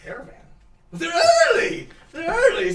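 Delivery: very high, loud alarmed cries; panicked crowd. Acted. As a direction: gasp that they're early. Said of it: "everyone's like".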